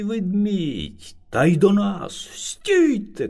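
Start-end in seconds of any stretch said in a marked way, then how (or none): 2.3-3.3 high sharp mock shout of the other bear. A narrator's reading. Other bear stops the animals with a shout. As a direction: call out a halt, lightly acted. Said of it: "кричить".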